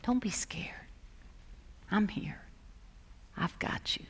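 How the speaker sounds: put-on hushed comforting whisper to a scared child; soothing whisper. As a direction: whisper it like a parent calming a frightened kid. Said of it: "They'd say".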